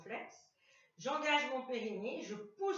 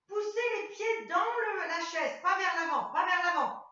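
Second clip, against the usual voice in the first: raised, louder correction; loud coaching call; 6 semitones higher, 8 dB louder than usual. Real, not acted. Coach corrects the class loudly, raised correction. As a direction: call it out across the room.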